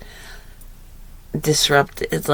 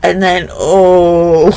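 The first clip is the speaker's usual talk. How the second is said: normal talking voice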